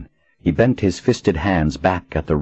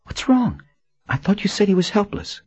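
frightened high voice. A narrator's reading.

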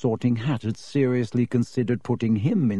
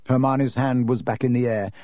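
ordinary delivery

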